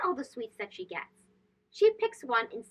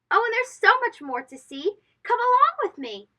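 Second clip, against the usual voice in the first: bright, higher sing-song call to come along; excited invitation; 8 semitones higher, 5 dB louder than usual. A narrator's reading. Narrator calling young listeners onward, bright and excited. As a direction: lift it up like beckoning a child to follow.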